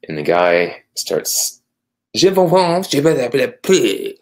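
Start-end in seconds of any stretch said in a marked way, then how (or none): 2.1-4.1 loud, high mock French gibberish; fake French. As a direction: babble fake French like a guard. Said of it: "That was my bad French, fake French there".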